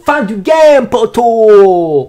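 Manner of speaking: loud, high cry with stretched vowels; drawn-out shout. Acted. Capping the troll's punchline for viewers, drawn-out triumphant shout. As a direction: belt it out like a final victory call.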